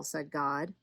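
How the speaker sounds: usual speaking voice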